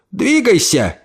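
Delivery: high clipped command; staccato. Acted. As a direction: bark the order short. Aunt commanding her body.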